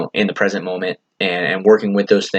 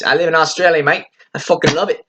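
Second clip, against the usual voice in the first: higher-pitched put-on Aussie accent; Australian accent; 8 semitones higher than usual. Proud local saying where they live, Australian accent. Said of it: "hopefully that was a good accent".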